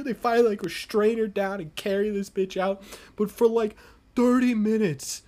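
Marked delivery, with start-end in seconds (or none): none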